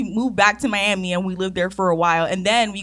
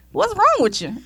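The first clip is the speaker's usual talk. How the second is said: higher, puzzled Jamaican grandma; a grandma scolding a kid; 9 semitones higher than usual. Retold or imitated. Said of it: "She's like".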